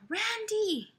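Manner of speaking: high squealed call; screaming fangirls. Acted. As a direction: shriek the name like an adoring fan. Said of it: "cuando las chicas gritaban". (grito agudo de chica enamorada)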